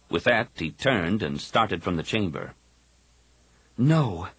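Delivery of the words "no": ordinary talk, nothing marked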